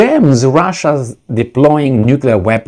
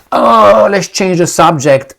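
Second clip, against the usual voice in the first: higher, louder stalling "uh"; mock evasion; 7 semitones higher, 3 dB louder, slower than usual. Mimicking the United States changing the subject, mock evasive. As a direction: stall loudly, then dodge in a high mocking voice. Mocking US evading his question.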